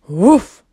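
one big rising woof; dog's woof. A narrator's reading. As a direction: bark it out like a dog. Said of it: "said the dog".

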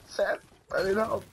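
high-pitched, stretched-out plea; pleading, pitch leaping up; urgent call for help. Real, not acted. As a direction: call out to a teammate in alarm. Calling teammate for help under attack.

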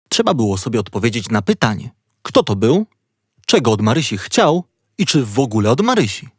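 usual speaking voice

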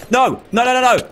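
high rapid pleading nos; panicked no. Real, not acted. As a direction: beg it to stop. Witch attacking him.

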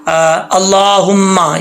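अल्लाहुम्मा with a thick, full ला and a held म; slow अल्लाहुम्मा with a heavy ला; heavy lam. Shown as a demonstration. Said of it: "अल्लाह का ला मोटा पढ़ा जाएगा".

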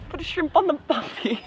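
softly attempted Australian accent; quiet, jokey Aussie accent; mock Australian accent. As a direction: have a half-hearted go at Australian. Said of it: "the closest Australian we get".

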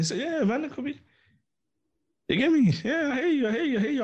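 mock squeak to tease the guys; squeaky high voice. Host slips into falsetto while wrapping up, squeaky.

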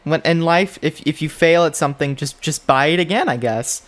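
normal talking voice

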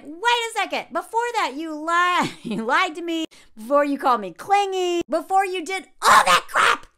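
hammed-up indignation rising to a yell; worked-up girlfriend. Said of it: "now she looks crazy".

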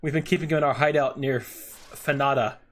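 lightly voiced grateful Scottish bandit; a hint of Scottish, thankful and eager. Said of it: "why he's Scottish now".

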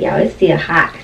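ordinary talk, nothing marked

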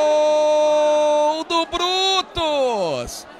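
long stretched goal scream; explosive goal commentary. Acted. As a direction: roar the goal call, drawing out gol. Narrator calls a goal.

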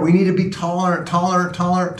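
usual speaking voice

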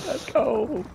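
high, excited cheer; celebrating. Real, not acted. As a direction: cheer the win twice. Celebrating the win.

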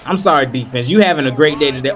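ordinary delivery

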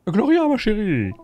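voiced caller. Reading a phone call scene.

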